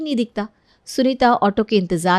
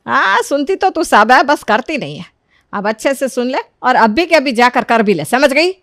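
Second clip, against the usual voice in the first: firm, raised-voice telling-off; scolding mother; 4 semitones higher, 5 dB louder than usual. Acted. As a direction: order your daughter to do it now. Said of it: "चिल्ला रही हो". (सख़्ती से डाँटती माँ)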